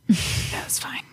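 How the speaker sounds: a breathy laughing whisper; whispered aside. Real, not acted. Player laughing off the jealous girls in a whisper. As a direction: murmur it under a laugh.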